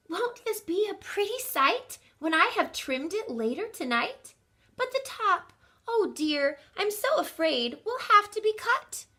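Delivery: high, fretful maid's voice; Miss Adelaide sighing. Acted. Maid fretting over her tree, high and sighing. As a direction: lift it high and worry over the top. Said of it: "sighed Miss Adelaide".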